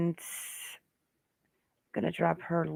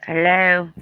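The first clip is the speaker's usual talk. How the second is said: imitating a vape hello; 8 dB louder than usual. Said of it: "she's like".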